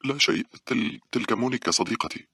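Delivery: high, stumbling reply; flustered. A narrator's reading. Paula hastily introduces her friend Monica, flustered. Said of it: "قالت باولا بارتباك".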